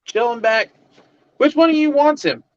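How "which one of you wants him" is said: high nurse voice. Retold or imitated. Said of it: "Just like this".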